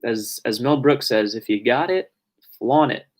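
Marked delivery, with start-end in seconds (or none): none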